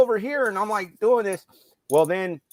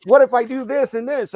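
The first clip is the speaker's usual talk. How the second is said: louder, high-pitched sarcastic question; mock exasperation; 8 semitones higher, 6 dB louder than usual. Coach to inattentive player, retold sarcastic exasperation. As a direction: re-enact an exasperated coach trying anything to get attention.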